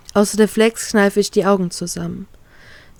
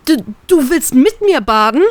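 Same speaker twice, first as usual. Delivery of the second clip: higher, louder stammered disbelief; agitated; 5 semitones higher, 4 dB louder than usual. Acted. Heroine blurting at Trafalgar in the bath, agitated. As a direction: blurt it out, flustered and upset. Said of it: "frage ich aufgebracht".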